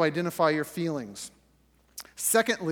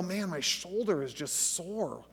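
softer, slow sleepy complaint, just out of bed; groggy morning grumble; 7 dB quieter, slower than usual. Acted. Pastor acting out waking up sore, groggy and soft. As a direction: mumble it like you just woke up stiff.

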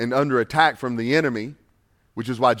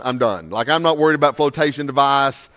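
normal talking voice

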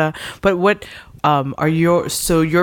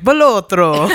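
louder exaggerated Italian accent on "Bellotro"; 5 semitones higher, 6 dB louder, slower than usual. Shown as a demonstration. To friends, showing how the surname sounds in an Italian accent. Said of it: "say it with an Italian accent".

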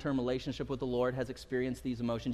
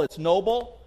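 ordinary delivery

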